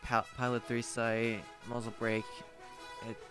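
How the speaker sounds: echoing duplicated audio; warpy double audio. Real, not acted. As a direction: voice doubled by headphone leak. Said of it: "it sounds really warpy and weird".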